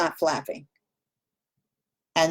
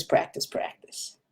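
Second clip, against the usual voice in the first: whispered; 9 dB quieter than usual. Real, not acted. Teacher urging practice.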